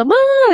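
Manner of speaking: mother imitates a child grunting to get something; toddler's demanding grunt. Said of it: "atau apa gitu".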